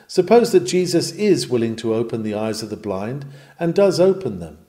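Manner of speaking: ordinary talk, nothing marked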